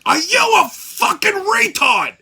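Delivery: high, slow furious yell; going ballistic at the clerk; ballistic shout. Retold or imitated. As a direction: scream it across the shop. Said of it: "I'm like".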